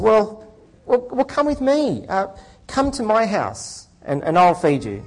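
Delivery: high, generous-sounding lawyer. Acted. Lawyer voiced in joke.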